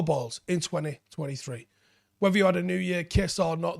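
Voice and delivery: upbeat, punchy sponsor read. Acted. Host reading a Manscaped ad to listeners, upbeat ad voice. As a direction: sell it like a radio spot.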